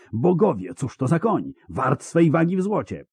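high-pitched exclamation of wonder; excited, rising outcry; amazed cry. A narrator's reading. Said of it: "krzyknął Dakr Silifant".

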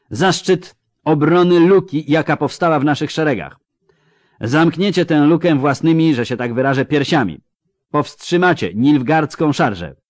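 loud, mocking commander addressing his troops; drwiący wojewoda. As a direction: sneer at the pikemen like a scornful noble officer. Voivode continues speech. (szyderczy wojewoda przemawia głośno do pikinierów)

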